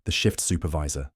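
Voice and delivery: ordinary delivery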